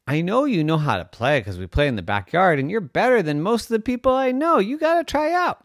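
quick, high mom pep talk; encouraging mommy. A narrator's reading. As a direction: urge the child on, fast and high. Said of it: "her mommy said".

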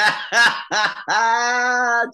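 loud, high-pitched cackle; real burst of laughter. Real, not acted. Guest reacting to host's Celtic joke, real loud laughter.

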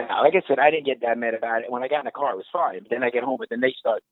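normal talking voice

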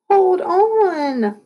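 high, drawn-out cry; panicked call. A narrator's reading. Kid being towed by a foxhound, panicked. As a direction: wail it as control slips away. Calling out during skateboard wipeouts.

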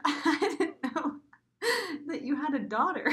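high, halting, laugh-choked delivery; fighting back laughter. Real, not acted. Usher's line to Kevin retold, choked with laughter. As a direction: push the words out between real giggles.

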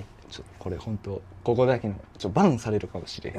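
mock-secret hush before revealing a favorite food. Real, not acted. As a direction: lean in and keep it secret. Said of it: "こそこそ言うな".

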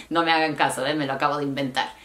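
ordinary talk, nothing marked

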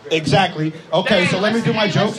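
ordinary talk

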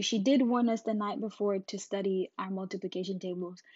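usual speaking voice

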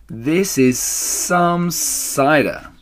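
high, very slow relish; awed gasp. A narrator's reading. Smallest fox marvelling at the cider, slow awed gasp. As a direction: drag the words out in amazement. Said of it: "gasped the smallest fox".

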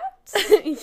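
a burst of high, loud laughter; real bright laughter. Real, not acted. Laughs at the joke.

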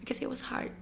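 whispered confession. Real, not acted. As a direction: breathe it out, almost unvoiced. Emotional confession. (berbisik lirih penuh haru)

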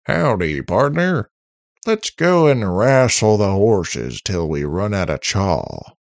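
rootin'-tootin' movie cowboy; cowboy impression. A narrator's reading. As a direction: holler it like a hammy cowboy. Robot switches to cowboy act.

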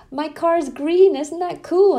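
high, loud and slow with delight; a boy showing off something cool; excited kid. Retold or imitated. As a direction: son thrilled by the app. Son thrilled about green car.

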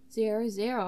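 usual speaking voice

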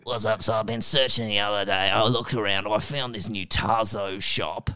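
lightly put-on British accent; mock British voice, run on without a break; a hint of a badly faked British accent; Pierce Brosnan. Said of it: "you could use your real voice".

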